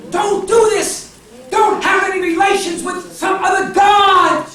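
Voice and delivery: high, loud, anguished shout as God; preacher's full-force acted outburst; impassioned divine plea. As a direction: cry out like a jealous lover pleading. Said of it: "That's why the Lord said".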